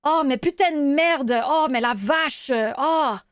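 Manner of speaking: lightly acted France French swearing; France French cursing. Shown as a demonstration. Showing how a French person curses you out, mild France French cursing. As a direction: swear mildly like someone from France. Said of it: "here's a France French person cursing you out".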